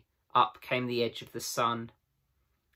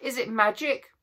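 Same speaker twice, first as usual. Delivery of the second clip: frightened; 12 semitones higher than usual. A narrator's reading. Susan frightened at broken table.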